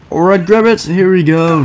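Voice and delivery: high, loud urgent cheer; excited. Real, not acted. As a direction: push through the tense jump. Player mid tense climb.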